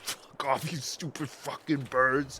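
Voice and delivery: mock angry. Real, not acted. Swearing at birds, laughing.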